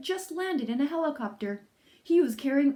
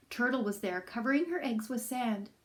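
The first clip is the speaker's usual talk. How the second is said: ordinary delivery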